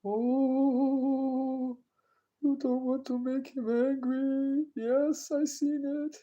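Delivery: high, mocking voice as Dom warning him; squeaky take-off of a nervous friend; imitating Dom. Mimicking Dom warning about Paul's anger, high and mocking. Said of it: "He went".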